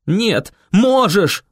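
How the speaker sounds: high, drawn-out, forceful yell. A narrator's reading. Boy right in Viola's face, yelling. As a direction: yell it right at her, stretching the words. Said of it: "заорал я ей прямо в лицо".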